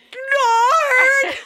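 piercing high mock scream; blood-curdling shriek. Mister Belvedere screaming as he sits on them, high shriek. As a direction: shriek it like the pain is unbearable. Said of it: "a blood-curdling, like".

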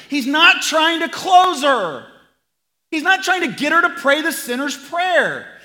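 loud, high, driving preacher voice; emphatic pulpit shout, slowing on the big lines; preaching at full force. Acted. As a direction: hammer the point home to the congregation.

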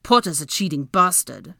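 sore, forceful grumble; grumbling. A narrator's reading. Draco losing the argument about Potter, grumbling. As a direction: grumble it out, sounding resentful. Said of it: "Draco grumbled".

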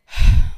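loud, heavy exhale; heavy sigh. Real, not acted. Reflecting on the woman being roofied, heavy sigh. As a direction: let out a big sigh. Pauses on the hard reality.

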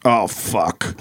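bar guy's groan. Retold or imitated. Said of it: "this guy sitting in the bar goes".

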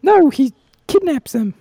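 slow high-pitched squeak; high squeaky cricket voice. Acted. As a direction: squeak it out slowly.

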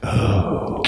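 hushed, drawn-out audience gasp; imitated gasp. Panel audience gasps at the challenge, imitated gasp. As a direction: mimic a stunned gasp. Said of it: "there's this gasp of silence".

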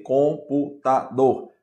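"Computador" slowly split as com-pu-ta-dor; syllable by syllable. Shown as a demonstration. Teacher demonstrating syllable division to students, "Computador" syllable by syllable. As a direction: break "Computador" into four separate, slow beats. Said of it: "Cada sílaba é uma emissão de som".

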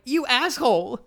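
shrill high outrage at being dumped; outraged. Retold or imitated. Sister told to leave by Casey, high outraged cry. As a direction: spit it out, high and stunned.

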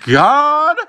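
building to a high, drawn-out mock scream; hammed-up wail, soaring on the last word. Woman making a scene in the ER, mock screaming. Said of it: "she was just, like, screaming out".

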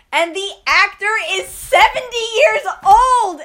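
real laughing exclamation; shocked outburst. Real, not acted. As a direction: blurt it out, still stunned. Shocked reveal of actor's age.

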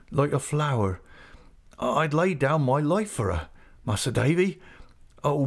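put-on a simple young fisherman struggling for words; halting, moved declaration of love; faltering. A narrator's reading. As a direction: Ham telling the gentlemen how he loves Emily, haltingly. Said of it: "with much faltering and great difficulty".

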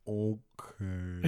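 very slow, low, drawn-out impression; odd-talking coworker. Host imitates the weird dude at the law firm, low and slow. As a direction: stretch it out, low and quiet like a dazed man. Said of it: "no one talks like that".

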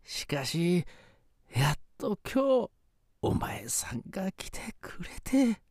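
put-on slow, high old ghost; quavering grateful spirit grandpa. Ghost grandfather speaks to woman.

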